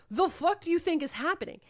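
high-pitched retold disbelief; incredulous outrage. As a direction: demand an answer in mock outrage. Strangers confront captor in retold scene.

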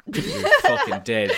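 laughing. Pretend. Echoes threat, laughing.

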